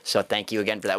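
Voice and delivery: ordinary talk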